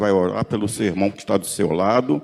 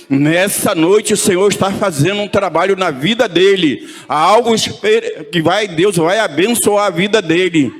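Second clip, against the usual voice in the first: louder, higher, driving preacher's cry; fervent preaching; 5 semitones higher, 7 dB louder than usual. Acted. Pastor rallying the congregation to pray, fervent loud preaching. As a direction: push every phrase out to the whole church.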